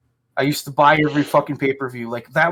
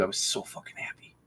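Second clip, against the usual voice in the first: breathy whisper, much softer; whispered aside; 6 semitones lower, 15 dB quieter than usual. Real, not acted. Podcast host whispering how happy wrestling once made him. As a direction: drop to a hushed whisper to savor it. Repeats his line as a whisper.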